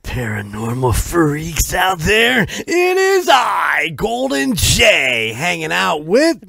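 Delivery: loud, slow, high announcer yell; shouted show intro. Acted. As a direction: bellow the welcome like a ringmaster.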